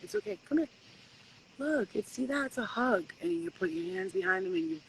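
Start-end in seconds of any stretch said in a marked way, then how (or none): 0.0-3.0 high, soft soothing voice; calm the monster like a child; tender soothing. Pretend. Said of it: "you walk up to him".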